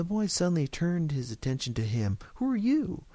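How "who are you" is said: put-on high, challenging child's voice; pugnacious small boy, pitched way up; belligerent little boy. A narrator's reading. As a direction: demand it like a pushy kid. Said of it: "he demanded belligerently".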